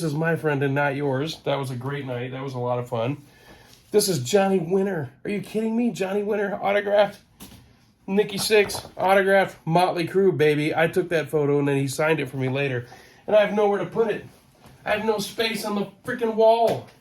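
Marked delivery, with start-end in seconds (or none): none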